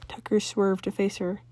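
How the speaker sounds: usual speaking voice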